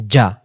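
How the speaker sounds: জা with a firm j sound; hard j. Shown as a demonstration. Teacher demonstrating jim with fatha, hard sound. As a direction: strike জা with a hard j. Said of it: "শক্ত আওয়াজে".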